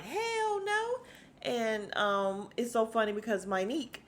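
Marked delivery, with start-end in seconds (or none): 0.0-1.0 high, squealed mock refusal; emphatic refusal. Portia answering the question about the mate, high mock refusal. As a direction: throw it up high, a sharp no way. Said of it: "Portia's like".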